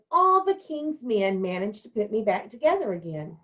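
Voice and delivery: ordinary talk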